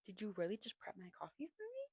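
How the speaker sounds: soft whisper rising into a question; hushed, breath-only question; whispered disbelief. Acted. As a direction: whisper it, touched and incredulous. Acts out her touched disbelief.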